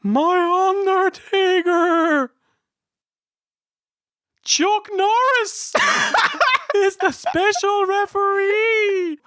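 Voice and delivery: high, slow, wailing showman; mock Paul Bearer. Host parodying the Paul Bearer promo, high wailing and slow. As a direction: stretch it out high like a wailing manager.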